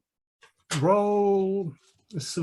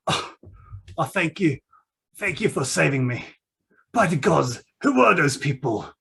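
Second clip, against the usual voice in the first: rescued captive. Acted. GM voicing rescued Benedict Rose.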